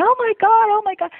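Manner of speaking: very high, fast mock panic; retold screaming. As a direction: squeal it frantically, as if re-enacting the scream.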